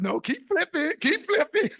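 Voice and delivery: egging her on through a laugh; laughing urging. Retold or imitated. Teasing his wife to keep turning pages, laughing.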